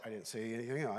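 ordinary talk, nothing marked

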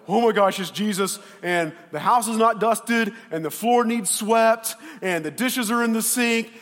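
high, loud mock panic; freaking out. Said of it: "she's freaking out".

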